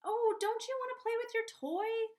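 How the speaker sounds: put-on high, loud, sing-song offer to a crying child; sugary distracting parent. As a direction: sweeten it up like you're bribing a toddler.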